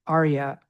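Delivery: "Arya" said AHR-yah with open A; Arya, A as in father. Shown as a demonstration. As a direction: model the word slowly. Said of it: "There we have the A in father again".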